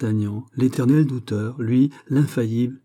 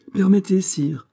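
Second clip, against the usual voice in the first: quick-witted, higher-pitched appeal; lively interjection; 10 semitones higher, 4 dB louder than usual. A narrator's reading. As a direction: cut in eagerly, pitched well up. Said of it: "dit vivement D'Artagnan". (vivement, d'un ton plus aigu)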